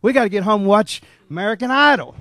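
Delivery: impatient crowd griping in the checkout line; grumbling shoppers. Retold or imitated. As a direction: complain loudly like a fed-up shopper. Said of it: "everybody was kinda grumbling".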